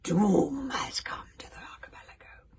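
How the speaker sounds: loud whispery doom-laden witch; scratchy witch voice. A narrator's reading. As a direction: rasp out the doom. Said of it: "her voice the spine-jangling scratch of nails on a blackboard".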